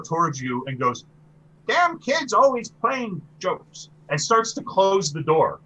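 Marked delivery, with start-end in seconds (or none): 1.7-3.9 put-on high, slow, cranky old lady; old woman. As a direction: grumble like a put-upon little old woman. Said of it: "she sounds old".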